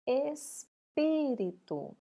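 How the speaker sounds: "espírito" split after ES, strong PÍ; stressed syllable. Shown as a demonstration. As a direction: sound out "espírito" slowly, hitting PÍ. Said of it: "qual que nós falamos mais alto?". ("espírito" devagar, com força no PÍ)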